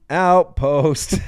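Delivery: loud, high announcer call. Pretend. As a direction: shout the name like an arena announcer. Announcing the winner's name.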